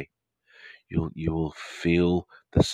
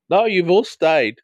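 high, loud and a little slow, much louder; delighted relief; 12 semitones higher, 8 dB louder, slower than usual. Real, not acted. Host relieved his viewers stayed, delighted and loud. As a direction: cheer that nobody left.

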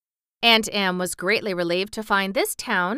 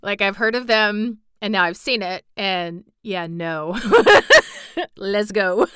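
laughing aside; 4 dB louder than usual. Real, not acted. Reader's own laughing aside.

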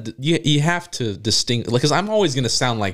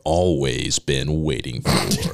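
movie trailer voice; 8 semitones lower than usual. Acted. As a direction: boom it like a monster truck ad. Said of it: "that sounded good".

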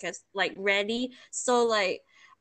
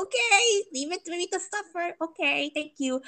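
high-pitched sarcastic resignation; laughing, high mock martyr; mock acceptance; 6 semitones higher than usual. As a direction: act put-upon with fake gratitude. Acted sarcastic reaction to being abandoned.

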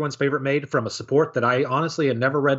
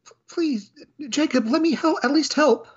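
much higher, apologetic maid voice that ends in a gasp; a timid maid caught out, sorry and panicking; flustered clumsy maid. Acted. Maid Felicia apologises to butler Jacob and then drops the snacks, in a high flustered voice.

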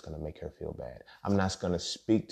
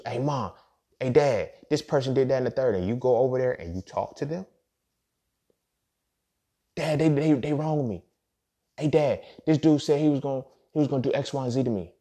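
higher, a hint of a whiny child tattling; lightly voiced complaining kid; 6 semitones higher, 4 dB louder than usual. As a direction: play a kid running to dad, only lightly. Voicing a kid telling parents.

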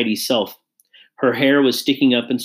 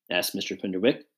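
ordinary delivery

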